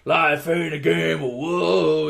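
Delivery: drawn-out mock rapper catchphrase. Rapper walks up to the racers, imitated rapper's drawl. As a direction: draw it out like a rapper's hook. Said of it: "[name] walks up and is like".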